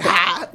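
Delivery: single burst of mock laughter; abrupt out-loud laugh, imitated; imitated dad's laugh. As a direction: imitate one sudden "ha" of laughter. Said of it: "my dad laughed out loud".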